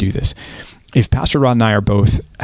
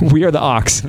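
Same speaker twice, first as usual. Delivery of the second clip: amused, higher, breaking into a laugh; 4 semitones higher than usual. Real, not acted. Pastor joking that pastors are oxen, laughing. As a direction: laugh through the words. Laughing at his own comparison.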